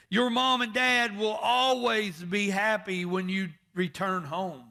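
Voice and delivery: loud, rising preacher's cry; full-force preaching. Acted. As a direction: preach it out to the pews.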